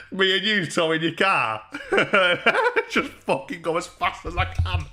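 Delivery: words squeezed through real laughter; can barely get it out for laughing. Real, not acted. Streamer cracking up at the towing plan, laughing.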